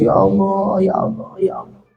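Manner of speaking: high sobbed cry fading to voiceless breath; acted tearful gasping; imitated weeping. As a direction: imitate a crowd crying out to God, fading away. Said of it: "Semuanya nangis".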